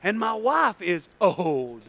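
drawn-out comic protest; slow complaint. Acted. Old man explaining about his wife, slow complaint. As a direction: stretch it out, lingering on the last word.

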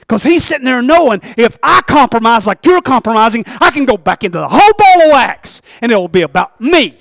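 loud fervent sermon delivery; rising full-voiced preaching; preaching at full force. Real, not acted. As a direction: preach it loud and high to the congregation.

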